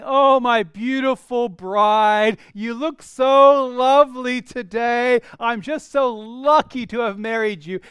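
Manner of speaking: loud, drawn-out gushing sweet talk; flattering husband. Acted. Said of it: "A husband coming to his wife and saying".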